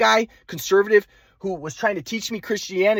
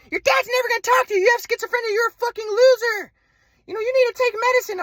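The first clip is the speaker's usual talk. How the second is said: much higher mocking mother; mock mom voice. Son mocking his mother's insults on the phone, high-pitched. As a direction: parody mom's insults in a high voice. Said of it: "my mom's like".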